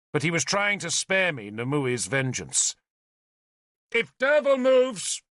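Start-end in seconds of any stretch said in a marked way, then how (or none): 3.9-5.2 high, slow call. A narrator's reading. Galahad calling a threat across to Nimue, calling out. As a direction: call it out as a warning. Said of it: "he called to Nimue".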